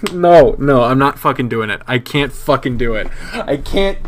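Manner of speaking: ordinary talk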